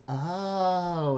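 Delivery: a small realizing oh; high little kid oh; Carbonate voice. Acted. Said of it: "He says".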